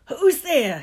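high, loud shout. Acted. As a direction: yell at the door. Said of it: "he shouted".

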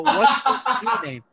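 slow question broken by laughter; drawn-out asking, laughing through it; laughing exasperation. Retold or imitated. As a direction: crack up midway through the retold question. Retelling exasperation, breaking into laughter.

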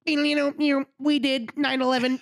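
slow, high alien voice. Pretend. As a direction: speak like a visiting alien. Alien bit begins.

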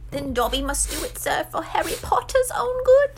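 high elf voice climbing to a squeak; tragic Dobby. A narrator's reading. House-elf acts for Harry's good, tragic high voice. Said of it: "Dobby gave him a tragic look".

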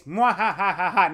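slow hammy villain laugh; evil cackle. Retold or imitated. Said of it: "He just goes".

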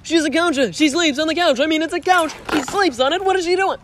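high, excited George rant; doing George from Seinfeld; George impression. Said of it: "they're like".

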